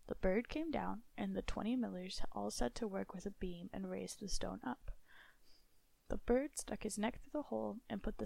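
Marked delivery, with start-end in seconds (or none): none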